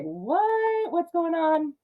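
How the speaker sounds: high, startled double take; mock bewildered cry; surprised. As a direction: blurt it out, suddenly alarmed. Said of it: "it's like".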